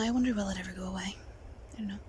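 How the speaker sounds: fast, whispered to herself; whispered aside. Real, not acted. Wondering if nerves will pass, whispered aside. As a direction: mutter it to yourself, unvoiced. Musing on nervousness.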